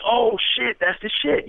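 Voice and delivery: high, bright burst of hype; excited approval. Retold or imitated. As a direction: sell it like the hook just clicked.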